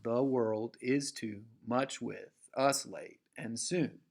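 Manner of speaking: slow scansion stressing world, too, with, late, soon; the world IS TOO MUCH WITH us read foot by foot, lifting each second beat; rising iambic rhythm. Shown as a demonstration. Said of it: "It's a rising line of poetry".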